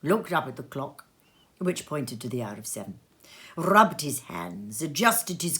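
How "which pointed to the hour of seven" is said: ordinary delivery, nothing marked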